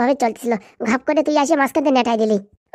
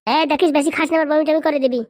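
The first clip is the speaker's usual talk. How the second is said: ordinary talk, nothing marked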